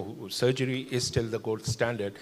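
usual speaking voice

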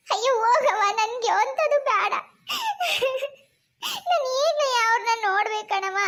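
acted sobbing refusal; tearful wailing. Wife begs to see her missing husband, crying. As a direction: wail through sobs, pleading. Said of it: "ಹಿಂಗೆಲ್ಲ ಅಳ್ಬಾರ್ದು ಕಣವ".

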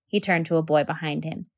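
ordinary delivery

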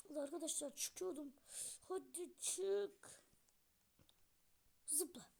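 ordinary talk

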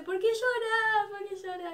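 high, drawn-out imitated question; slow, high-pitched mock concern; concerned idol. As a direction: imitate a star fussing over a crying fan. Idol asks crying fan why.

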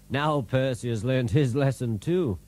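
usual speaking voice

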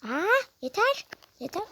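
fed up, cry out to stop; frustrated shout. Real, not acted. Mother yelling over the meat she can't cut. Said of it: "Anne bağırma".

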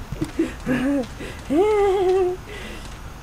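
long, high squealing laugh; high drawn-out laugh. Real, not acted. Gamer chasing another player, high laughter.

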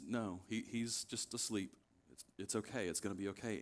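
soft, low, calming voice; gentle reassurance. Retold or imitated. Jesus calmly reassures the mourners.

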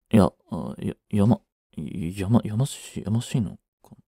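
trailing off as he realizes; flustered mumble. Acted. Caught by his friend, he stammers in a low, soft mumble.

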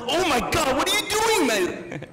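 high loud disbelieving shriek; shocked dad. Retold or imitated. Dad finding his son pooped in the closet, shocked shriek. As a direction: cry out in shock at the toddler's mess. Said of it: "I'm like".

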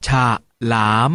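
ฉะ, then หลาม, split apart; syllable by syllable. Shown as a demonstration. Teacher leads class sounding out ฉะ ... หลาม together. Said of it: "มาออกเสียงคำเหล่านี้พร้อมๆกัน".

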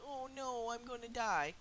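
fake-panic about dying, whining it; whining at viewers, mock-scared of dying; mock whine. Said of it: "see me scream, whine, moan, and cry".

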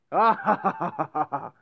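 high, loud burst of laughter. Real, not acted. Gamer laughing at the chaos, loud laugh.

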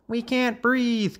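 high, slow little frog wail; young frog calling out. A narrator's reading. Another squashed frog in the pond, high slow call. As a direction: stretch it out in a high frog-child voice. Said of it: "called another".

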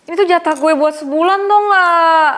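loud, high-pitched angry protest; marah. Acted. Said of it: "jangan marah-marah". (marah, protes dengan suara tinggi dan keras)

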